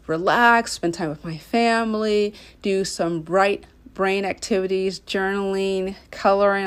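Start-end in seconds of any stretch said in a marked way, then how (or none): none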